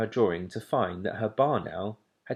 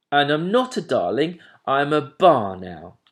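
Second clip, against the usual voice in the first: indignant owlet; 5 semitones higher, 8 dB louder than usual. A narrator's reading. Plop indignant.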